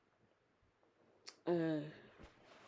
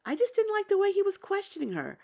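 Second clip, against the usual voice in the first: indignant disapproval; 13 semitones higher, 4 dB louder, faster than usual. Real, not acted. Disapproving of his questioning.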